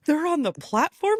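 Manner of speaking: high, rising disbelief; shocked question. Retold or imitated. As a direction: squeak it out in alarm. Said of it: "And I was like".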